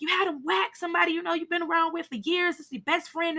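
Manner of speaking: high, fast disbelieving outburst; mock shocked viewer; incredulous. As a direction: voice shocked disbelief, pitched well up. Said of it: "You're like".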